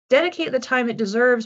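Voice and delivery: ordinary delivery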